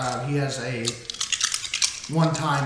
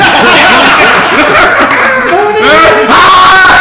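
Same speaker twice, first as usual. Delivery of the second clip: loud, high, long shrieking laugh; real uncontrollable laughing fit; helpless laughter; 16 dB louder than usual. Real, not acted. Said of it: "I was laughing too hard".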